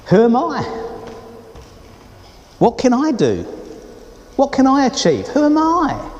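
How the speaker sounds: high, pleading self-doubt; doubting questions. Retold or imitated. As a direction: ask it like someone who feels small. Voicing a doubting nobody's thoughts.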